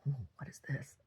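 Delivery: soft, nervous murmur; the girl, uneasy, in a soft murmur; hushed wary aside. Retold or imitated. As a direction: mutter it like a spooked young woman. Retelling the frightened girl's reaction.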